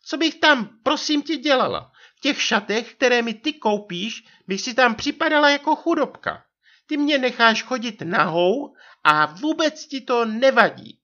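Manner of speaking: very high aggrieved wife voice; naříkající manželka. A narrator's reading. Narrator voices complaining wife. (ublížený vysoký hlas manželky)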